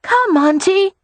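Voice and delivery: put-on a boy luring his aunt outside; coaxing little boy. A narrator's reading. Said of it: "he coaxed".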